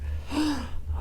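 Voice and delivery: quick breathy gasp; a soft startled intake of breath; mock gasp. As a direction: pretend frightened gasp. Reader reacts to warning.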